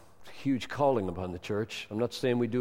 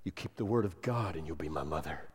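gentle reply; 8 semitones lower, 7 dB quieter, faster than usual. Acted. Jesus answers the woman.

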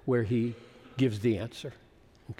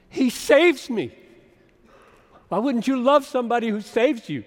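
excited; 8 semitones higher, 7 dB louder than usual. Real, not acted. Preacher's love for Paul.

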